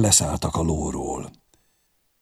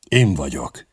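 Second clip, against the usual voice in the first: usual speaking voice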